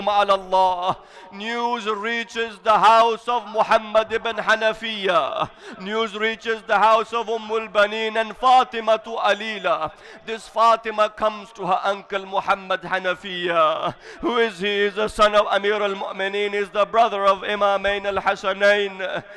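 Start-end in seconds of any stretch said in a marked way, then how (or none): none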